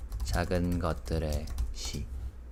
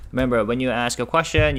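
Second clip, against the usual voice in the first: louder, faster emphatic reminder; 5 semitones higher, 6 dB louder, faster than usual. Real, not acted. Teacher reminding students, louder and quicker. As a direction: hammer home the rule to the class.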